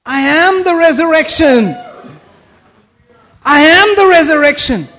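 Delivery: high, ringing declaration; emphatic proclamation. Retold or imitated. Preacher proclaiming Jesus' answer.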